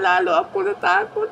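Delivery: high, scared little voice; mock scared. Sick man telling of his fear in a high scared voice. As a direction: squeak it out like a frightened patient.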